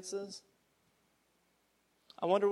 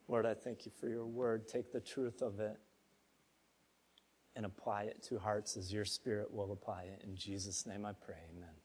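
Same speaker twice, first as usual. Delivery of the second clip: soft, low prayer voice; closing prayer; 8 semitones lower, 7 dB quieter than usual. Acted. Pastor closing the sermon with a soft, humble prayer. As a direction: pray gently and humbly.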